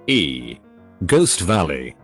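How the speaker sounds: ordinary delivery, nothing marked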